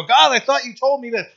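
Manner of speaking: high, loud panicked cry; scared plea to God. Retold or imitated. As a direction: blurt it out in a fright, high and loud. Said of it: "get scared and go".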